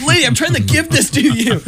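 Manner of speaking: exasperated cartoon candidate. The candidate pleads with his opponent, high and exasperated. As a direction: squeak out the plea in frustration. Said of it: "And he goes".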